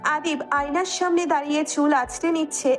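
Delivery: usual speaking voice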